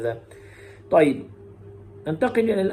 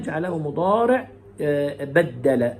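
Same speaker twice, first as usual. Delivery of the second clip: normal talking voice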